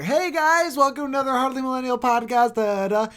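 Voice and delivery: high, loud, peppy host greeting; big upbeat show opener; podcast host intro. Retold or imitated. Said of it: "now when we start off".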